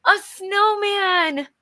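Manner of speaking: high, slow gasping cry of joy; joyful shriek. Shown as a demonstration. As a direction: shriek it out with real delight after a gasp. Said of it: "She said it very loudly".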